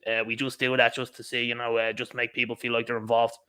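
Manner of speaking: low, toneless brush-off, slightly softened; mock disinterest. Politician fobbing off the public's comments, mock disinterest. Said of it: "No interest".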